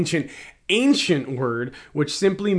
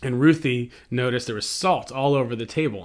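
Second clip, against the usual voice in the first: ordinary delivery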